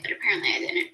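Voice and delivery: ordinary delivery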